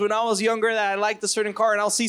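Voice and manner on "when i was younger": ordinary talk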